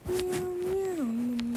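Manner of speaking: high, playful mock meowing; cat meows. Narrator breaks off into high playful cat meows. Random cat noises mid-commentary.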